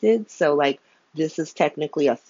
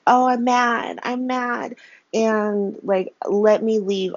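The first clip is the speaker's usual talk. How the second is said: higher, whiny mock sulk; mock tantrum; 5 semitones higher, slower than usual. Mocking Hat Daddy storming off in a tantrum, whiny and higher. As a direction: play the offended old man, sulky and slow.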